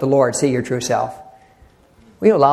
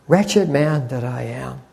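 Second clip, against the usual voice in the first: slow, softer imitation of a tearful confession; retold tearful confession; 4 semitones lower, 6 dB quieter, slower than usual. Preacher retelling old brother's testimony, understated tearful confession. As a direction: quietly mimic a grieving old brother, drawn out. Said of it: "he's crying, and he's saying".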